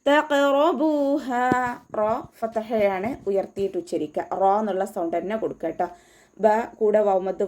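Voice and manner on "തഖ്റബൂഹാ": തഖ്റബൂഹാ with a bouncing, heavy ഖ്; qalqala on qaf. Shown as a demonstration. Teacher models the clear qaf stop, slow chant. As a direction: recite തഖ്റബൂഹാ slowly, ഖ് clearly heard. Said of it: "ഖാഫ് സുക്കൂനിൻ്റെ സൗണ്ടിനെ വ്യക്തമായിട്ട്".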